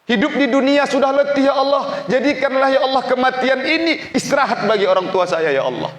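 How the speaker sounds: very high, loud pleading to God; retold funeral prayer, cried out at full force; impassioned prayer. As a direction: plead desperately for your father at the top of your range. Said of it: "Saya bilang".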